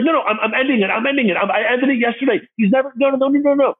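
high, frantic imitation of panicked pleading; freaking out. Caught-out woman swearing she's ending the affair, freaking out. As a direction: tumble over the denials in a high voice. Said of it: "She freaks out".

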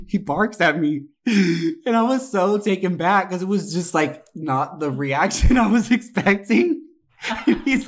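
giggling through the words; can barely get it out for laughing; laughing while telling. Real, not acted. Telling a friend the story, laughing.

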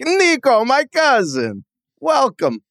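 high, excited Eastern European cousin voice, "Nico" shouted up high; GTA commercial impression. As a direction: ham up the game's cousin greeting with a thick Eastern European accent. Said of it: "I think about Grand Theft Auto four".

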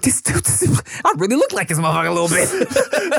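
high, laughing mock disbelief; amused disbelief. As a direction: retell it through bubbling laughter. Retelling wax-figure shock, laughing.

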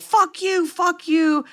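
high, imitated yelling into the phone; hammed-up furious caller; a retold drunk rage at his ex; mock angry yell. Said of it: "a montage of him calling".

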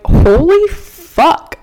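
loud, high, drawn-out mock shock; retold shock. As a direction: stretch it out in stunned disbelief, re-enacted. Acting out her shocked reaction.

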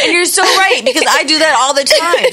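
high, loud and animated; excited. Real, not acted. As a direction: burst in agreeing. Animated laughing agreement.

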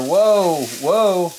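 loud, high startled whoa; excited whoa. Real, not acted. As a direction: cry out as the flip nearly fails. Tense sandwich flip lands.

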